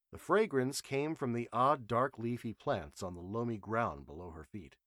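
ordinary delivery

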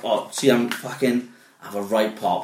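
ordinary delivery

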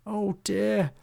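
high, worried little voice; gulped dismay. A narrator's reading. Hobgob sees a stranger charging at him, gulped dismay. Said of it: "gulped Hobgob".

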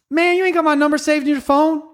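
high, loud put-on voice of a coworker; coworker calling me out. Coworker calling me out, indignant high voice. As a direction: pitch it way up, indignant.

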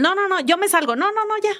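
quick mock protest, pitched high; lightly acted, high-pitched insistence; insistent protest. Acts out him insisting he leaves.

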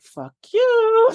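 squeaky high "Fuck you"; mock outburst. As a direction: fire it back in a jokey high squeal. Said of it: "I'm like".

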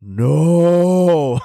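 one loud, drawn-out flat no; deep pronounced no. Retold or imitated. The son answers the toy dog with a firm no. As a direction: plant the word firmly, dead serious. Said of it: "it was so deep and so pronounced".

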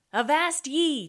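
loud, high pirate holler. A narrator's reading. Tootsie rousing the crew for help, hollering. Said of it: "Tootsie hollered".

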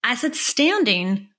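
standing drawn out slow and high; stress on standing. Shown as a demonstration. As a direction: lean on the word standing, slowly. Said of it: "I just wanna put a little emphasis on this".

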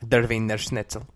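put-on "Der Wiener Schnitzel" with a German accent, "vee-ner". Teasing the point about accents, answers in a German accent. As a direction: say it like a proud German. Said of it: "Do you see what I mean?".

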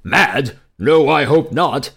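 loud, drawn-out royal reply; excited shout. A narrator's reading. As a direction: throw it back with mild excitement. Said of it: "shouted the king in excitement".

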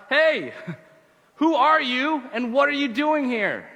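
high, loud challenge from a guard; crying out. Acted. Roman guard challenging a man at the gates, crying out. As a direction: call out a drawn-out challenge to a stranger. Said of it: "he hears a voice cry out".

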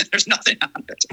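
laughing in disbelief, pitched high. Real, not acted. Guest on the blank package insert, laughing. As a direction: say it through a real laugh.

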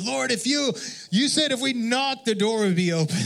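high insistent plea to the Lord; pleading prayer. Retold or imitated. Preacher retells knocking on the glass door, high pleading prayer.

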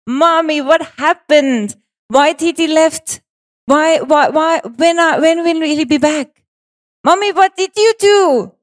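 put-on high child's voice full of questions; young son asking. Her five-year-old son asking why he left, high and childlike. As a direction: voice a worried little boy, pitched high. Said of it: "He was asking me".

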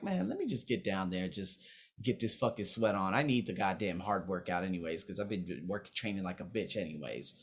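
low muttered aside. Retold or imitated. Quoting himself wanting workout.

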